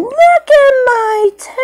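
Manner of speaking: high squeaky show-off; very high cat voice. Pretend. Repeated higher.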